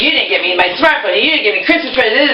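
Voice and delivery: high whiny mocking mom; sarcastic mimicry. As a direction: whine it like a spoiled complainer. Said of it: "I was sarcastically saying".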